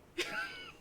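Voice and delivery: a quick squeaky burst of laughter; delighted at the bunny reveal; real laugh. Real, not acted.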